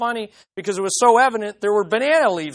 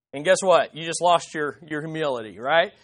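normal talking voice

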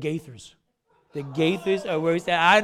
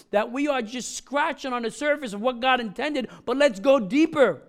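higher, louder, rising sermon fervor; building preaching; 4 semitones higher, 4 dB louder than usual. Acted. Pastor urging the congregation toward unity, higher and louder preaching. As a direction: drive the point home with mounting passion.

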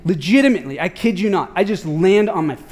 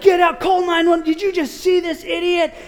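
high, shouted and urgent; urging a bystander to call for help, high shouted; 8 semitones higher than usual. Retold or imitated. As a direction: yell it across the road. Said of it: "And I'm like".

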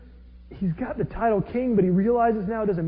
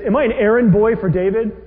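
loud, raised retort; indignant; 3 semitones higher, 7 dB louder than usual. Retold or imitated. Abner rejecting being David's errand boy, loud and indignant. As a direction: demand it, insulted.

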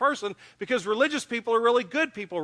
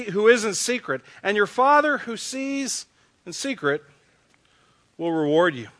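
louder, measured reading of the verses; scripture reading; 4 dB louder than usual. Acted. Pastor reading Matthew to the congregation, solemn proclaiming read. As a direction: proclaim each verse with weight and pauses. Reads verses sixteen onward.

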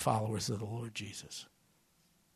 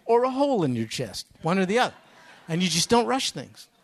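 a big loud jab, pitched high; loud joke; 6 semitones higher, 9 dB louder than usual. Real, not acted. Preacher hollering the punchline to the congregation, loud and high. Joking about strong coffee.

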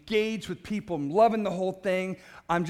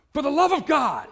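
loud, high exclamation, right in someone's face; shout; 7 semitones higher, 8 dB louder than usual. Retold or imitated. Scott calling out to people at the campus gathering, a loud high shout. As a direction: bellow it like a fervent preacher.

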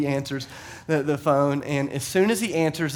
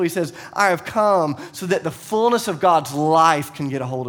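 louder, higher preaching voice; full-force preaching; 5 semitones higher, 5 dB louder than usual. Acted. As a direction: preach it with rising passion. Preaching Jesus' invitation.

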